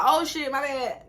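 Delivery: slow, sheepish oops; caught off guard. Retold or imitated. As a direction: sheepishly own the slip.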